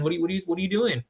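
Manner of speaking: fast, rattled-off disbelief; exasperated. Real, not acted. Broker scolding clients who won't fix credit, fast exasperation.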